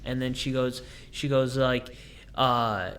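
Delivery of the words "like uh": ordinary delivery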